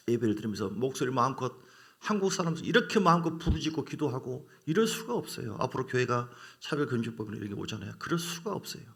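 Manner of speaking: normal talking voice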